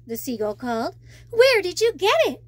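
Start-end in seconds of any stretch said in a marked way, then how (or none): 1.3-2.4 bright, higher and louder question; eager question. Acted. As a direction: ask it eagerly, voice up. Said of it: "I asked".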